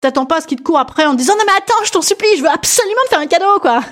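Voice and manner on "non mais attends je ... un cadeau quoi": high-pitched exaggerated begging; high, insistent mock plea; mock pleading. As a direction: plead as if chasing someone down. Imagined shopper begging to give a gift.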